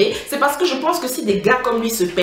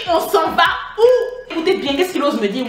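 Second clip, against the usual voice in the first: a stretched, fed-up on s'en va où; exasperated demand; 7 semitones higher than usual. Retold or imitated. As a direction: drag out où in mock disbelief. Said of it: "J'ai dit".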